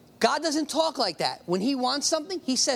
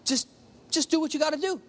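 casual, higher-pitched bro talk; encouraging buddy; 6 semitones higher than usual. Retold or imitated. Nathan casually cheering on David, encouraging. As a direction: lift it like a friend urging someone on. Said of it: "it was an encouraging word".

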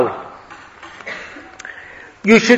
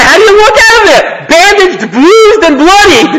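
rising higher and louder on each word, bandaged bruised bloodied; mock dramatic build; 11 semitones higher, 3 dB louder than usual. Teacher to students, joking about a baby's bris, mock dramatic. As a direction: pile on the comic horror, climbing in pitch. Comic baby leaving the bris.

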